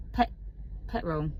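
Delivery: casual Essex "petrol"; own Essex accent. Retold or imitated. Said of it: "pick up on my accent".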